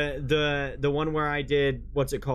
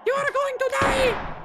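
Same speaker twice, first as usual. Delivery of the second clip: loud falsetto death threat; play threat; 5 dB louder than usual. Pretend. Streamer taunts a game creature, loud falsetto threat. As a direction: shriek a very high mock warning.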